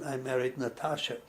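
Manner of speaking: ordinary delivery, nothing marked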